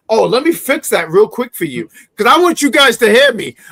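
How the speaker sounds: usual speaking voice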